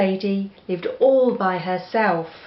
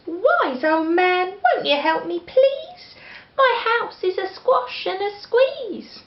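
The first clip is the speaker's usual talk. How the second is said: put-on high, pleading little old lady; a fussing old lady asking for help; 12 semitones higher than usual. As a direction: beg the wise man in a high, fretful voice. Old lady begs again.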